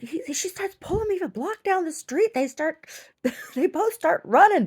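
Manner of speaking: bubbling over telling about the dogs; excited. Real, not acted.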